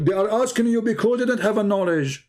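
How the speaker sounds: ordinary delivery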